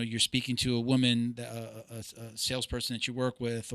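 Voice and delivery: ordinary talk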